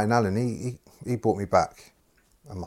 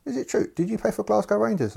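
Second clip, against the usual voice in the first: high-pitched, raised mimicking voice; manager impression, questioning; 14 semitones higher, 4 dB louder than usual. Manager quizzes new player about Rangers, high mimicking voice.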